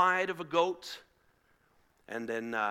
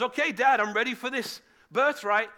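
loud, raised, hearty announcement; booming eagerness; 6 semitones higher, 6 dB louder than usual. Acted. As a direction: burst in as the confident hunter. Eager hunter Esau bursts in.

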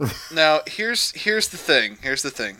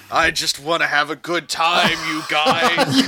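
scowling intense delivery; 2 semitones higher, slower than usual. Retold or imitated. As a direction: force out fun through a scowl. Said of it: "Just scowling like".

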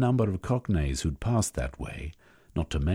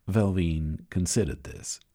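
ordinary delivery

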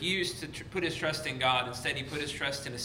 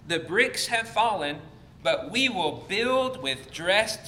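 raised, emphatic reading to a congregation; scripture reading; 3 semitones higher, 3 dB louder than usual. Acted. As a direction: proclaim the verses, with weighty pauses. Reads Bible passage aloud to congregation.

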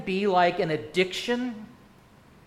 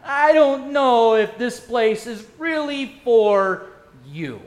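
a hint of a cold gatekeeper, slow and pointed; judging churchgoer; 2 semitones higher, 6 dB louder, slower than usual. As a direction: say it like shutting someone out. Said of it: "Where do you say".

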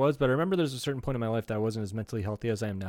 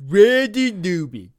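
slow, loud, higher imitation of the singer; 7 semitones higher, 9 dB louder, slower than usual. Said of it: "the guy that sings that Short People song".

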